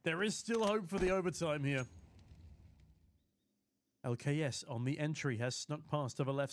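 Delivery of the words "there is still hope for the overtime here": higher-pitched esports caster call; excited play-by-play. Real, not acted. Caster to viewers as overtime is possible, excited play-by-play. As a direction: lift the pitch as hope returns. Live play-by-play, hope returns.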